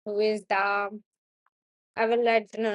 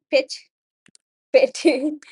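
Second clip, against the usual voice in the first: laughing at a slip; 4 semitones higher, 7 dB louder than usual. Real, not acted. Stumbling over a word, laughing.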